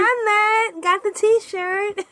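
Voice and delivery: high, drawn-out sarcastic dismissal; mock incredulous. Mocking the podcast's stale title.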